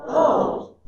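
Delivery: an "Oh" that means I understand. Shown as a demonstration. Group exercise saying O to mean I understand, understanding. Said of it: "I understand".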